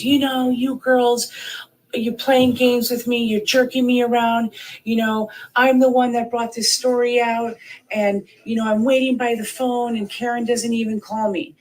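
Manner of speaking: high-pitched, imitated grievance; aggrieved complaint. Aiden complaining to the speaker about waiting by the phone, aggrieved. Retelling caller's complaint about being jerked around.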